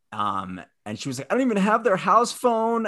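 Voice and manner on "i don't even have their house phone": high-pitched mock fluster; frazzled. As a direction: imitate a helpless, flustered friend up high. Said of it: "sounded frazzled".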